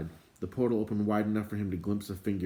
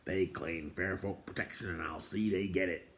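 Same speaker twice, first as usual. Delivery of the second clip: gruff dwarf; 6 dB quieter than usual. Acted. Dwarf insists firmly.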